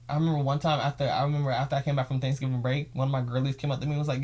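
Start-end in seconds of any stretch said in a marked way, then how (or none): none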